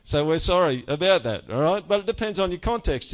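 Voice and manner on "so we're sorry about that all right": ordinary talk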